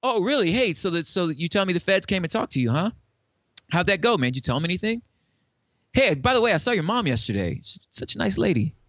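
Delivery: a thug's sweet veiled threat; mild mock menace over a nice word about mom; mob boss threat. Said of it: "It sounds like The Mob".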